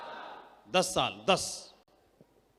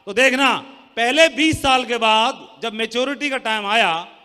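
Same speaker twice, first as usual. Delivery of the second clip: forceful preaching; 7 dB louder than usual. Real, not acted. Preaching to seminar crowd.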